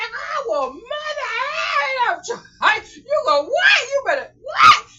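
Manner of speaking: very high, loud reenacted rage; enraged screaming. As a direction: scream as if straining against someone holding you back. Acts out fighting back when threatened.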